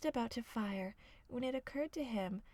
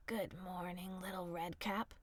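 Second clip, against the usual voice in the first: hushed sly wolf; 4 semitones lower than usual. A narrator's reading. Wolf greeting the girl in a low hush.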